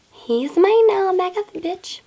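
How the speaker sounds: slow, high, catty "back off" to a rival; mock jealous warning. Jealous girlfriend warning the ex away, slow and high. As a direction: hiss it at the other girl like a territorial friend.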